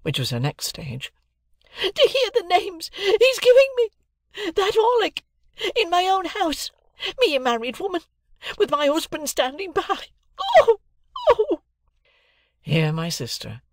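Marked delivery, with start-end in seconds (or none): 1.8-10.1 very high, hysterical outraged screaming; screaming fit. A narrator's reading. Sister complains of names Orlick gave her, screaming. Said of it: "a fit of clappings and screamings". 10.4-11.6 very high, loud wailing shrieks; shrieked, crying ohs; screaming fit. A narrator's reading. Sister on her road to frenzy, shrieking. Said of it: "a fit of clappings and screamings".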